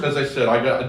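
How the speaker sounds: usual speaking voice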